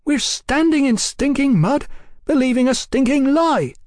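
high, loud indignant protest; vehement, high-pitched shouting; angry outburst. A narrator's reading. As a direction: burst out in disbelief and disgust. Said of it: "Brian burst out".

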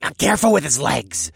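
snarling. Acted. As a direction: snarl it at them. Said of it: "someone snarled".

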